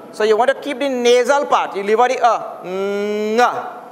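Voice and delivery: ordinary talk, nothing marked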